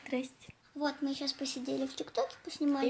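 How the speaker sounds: hushed aside to camera; whispering. Real, not acted. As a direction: keep it down, barely voiced. Шёпотом вечером у подруги. (шёпотом)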